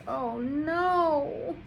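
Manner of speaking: high dismayed aside. Real, not acted. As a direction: cry out in worry. Reader's aside.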